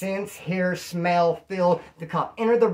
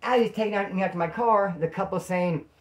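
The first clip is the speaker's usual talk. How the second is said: ordinary talk, nothing marked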